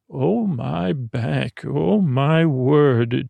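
very slow, drawn-out relief; luxuriating in a massage, slowly; back-rub groaning. Acted. Centaur getting a back rub.

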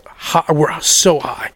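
normal talking voice

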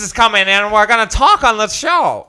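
put-on old-timey announcer. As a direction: announce it like an old sideshow barker. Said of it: "So I was like this".

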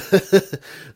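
loud real laugh; burst of laughter. Real, not acted. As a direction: crack up out loud. Host cracks up mid-question.

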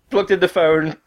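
normal talking voice

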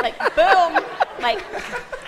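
high, loud laughing burst; giddy laughing boom. Real, not acted. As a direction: laugh through it with glee. Excited retelling at pharmacy.

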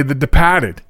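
"The Depahted", said the Boston way; Boston accent. Shown as a demonstration. Host showing how Massachusetts locals say the film's title, Boston accent. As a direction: imitate Bostonians, dropping the r in DEPARTED. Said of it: "that's how they say".

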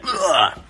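high exasperated ugh; frustrated grunt. Retold or imitated. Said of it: "negatively responding to that".

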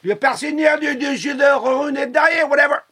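French conductor. Retold or imitated. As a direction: shout it like a French train guard. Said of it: "the man goes".

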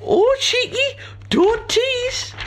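very high, loud put-on woman; falsetto woman voice. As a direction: shriek it as a saucy woman. Voices Beryl.

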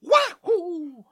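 very high jumping cry; Mario leaping into the air; Mario wahoo. Acted. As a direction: shout it high on the jump. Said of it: "the jump and then a wall bounce".

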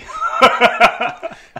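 high airy laugh burst; wheezing laugh; breathy laugh. Real, not acted. Laughing at DM joke.

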